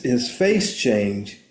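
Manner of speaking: ordinary talk, nothing marked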